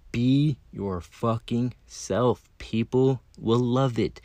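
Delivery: very slow, deliberate advice; slow and emphatic. Real, not acted. Speaker urging listeners to be themselves, slow and emphatic. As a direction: spell it out word by word. Driving home his closing advice.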